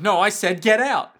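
loud, hammed-up order; mock angry guard shout; insistent guard. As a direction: order them out as a fed-up guard. Guard orders Isaac out, acted.

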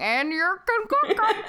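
slow, high troll breaking into laughter; laughing troll voice. Shown as a demonstration. Co-host replaying a botched line for friends, laughing high troll voice. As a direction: redo the troll line while cracking up. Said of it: "And I also read it wrong".

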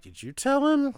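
high-pitched mock query; imagined aside between characters; acted-out question. Acting out two characters' silent exchange.

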